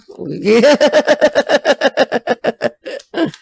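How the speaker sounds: a long, high, loud laugh. Real, not acted. Laughing after telling the man to leave her alone, high laugh.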